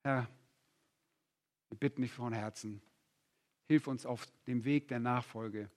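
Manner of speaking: quiet, earnest prayer voice; hushed pulpit prayer. Acted. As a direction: pray softly for the congregation. Leads closing prayer.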